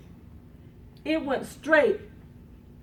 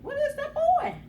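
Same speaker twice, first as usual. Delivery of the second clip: high-pitched anxious question; retold alarm; 3 dB louder than usual. Storyteller lying hurt worries about boy, high alarmed voice. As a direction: ask it high, in mock alarm.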